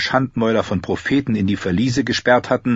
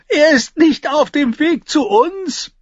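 high, slow, loud squeal; forced out laughing; 5 dB louder, slower than usual. A narrator's reading. Tyrion getting the news out to his sister, choking with laughter. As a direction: squeeze each word out past the laughter. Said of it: "brachte Tyrion heraus".

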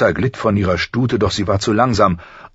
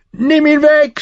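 hoher, lauter Schmerzensschrei; schreiend vor Schmerz; 7 dB louder than usual. A narrator's reading. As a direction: schrei panisch um Hilfe. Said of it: "schrie er". (der Prinz schreit, weil der Wolf ihn beißt, gellend)

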